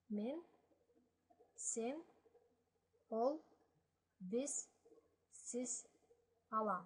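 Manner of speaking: each Balkar word said slowly and clearly, with a pause after each; Balkar pronouns read aloud. Shown as a demonstration. Teacher reads the pronouns to the class, in careful Balkar. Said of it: "So I'm just gonna read it through".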